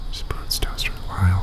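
unvoiced whisper, half to himself. Real, not acted. A host whispering while he searches the name. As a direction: breathe the name out while reading the screen.

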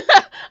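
big high laugh; real delighted laugh; loud burst of laughter. Real, not acted. Laughing at cohost's story.

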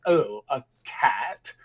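slow, disdainful put-on voice; mock-haughty elder turning up their nose, slowly; snooty older person. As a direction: sniff at it like a stuffy boomer. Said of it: "they'd probably be like".

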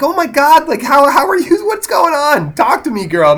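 high, gushing mock concern; playfully fussing over an upset grandma; mock sympathetic friend. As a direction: coo at her like a worried girlfriend. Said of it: "I'm like".

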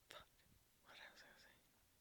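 whispered aside to herself; breathy whisper under her breath. Real, not acted. Aside to herself mid-sentence.

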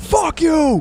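high yelled curse; angry driver. Retold or imitated.

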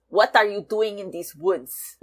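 mock pseudo-Austrian cartoon elf accent. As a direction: try the line lightly in a weird pseudo-Austrian accent. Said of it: "weird pseudo-Austrian accent".

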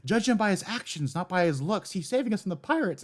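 high-pitched imitation of adoring townsfolk; earnest defenders. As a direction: pipe up in mock defence of a hero. Townspeople defending Crocodile in retold quote.

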